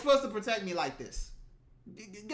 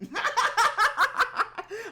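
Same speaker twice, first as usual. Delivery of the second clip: loud, high cackle; real high-pitched burst of laughter; big laugh; 14 semitones higher, 6 dB louder than usual. Real, not acted. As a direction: crack up loudly. Cracks up at own imagined reaction.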